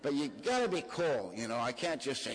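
ordinary talk, nothing marked

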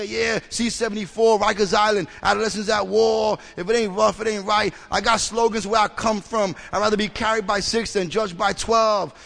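loud, rhythmic slogan reciting; tough-guy bragging. Retold or imitated. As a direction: rattle off street slogans like boasts. Mimics own tough-guy slogan-reciting share.

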